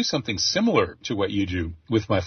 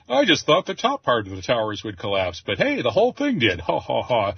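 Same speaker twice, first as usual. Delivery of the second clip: Fatty bin Laden chortling; 3 semitones higher than usual. Retold or imitated. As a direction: crow over it with a put-on ha-ha-ha. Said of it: "chortling about the, uh, success of the operation".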